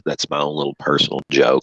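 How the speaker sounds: ordinary talk, nothing marked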